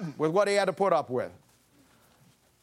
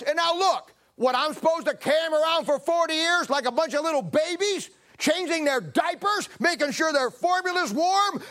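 exasperated shout; 9 semitones higher than usual. Acted. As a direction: yell it like a fed-up leader venting at God. Moses yelling at God.

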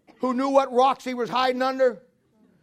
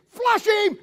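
shouted much higher; shouted command; 11 semitones higher, 4 dB louder than usual. Retold or imitated. God commanding the hunt on Adam, shouted command. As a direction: yell it like a hunter to his dog. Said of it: "when you hear God say".

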